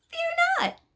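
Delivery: high, loud, frantic cry of fear not; excited shout. Acted. Angel Imperial frightening the rider, high excited shout. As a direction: buzz like an over-excited bumblebee. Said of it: "buzzed around him like an excited bumblebee".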